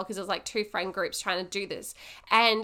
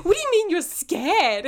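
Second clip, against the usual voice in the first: incredulous; 8 semitones higher, 5 dB louder than usual. Retold or imitated. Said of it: "he's like".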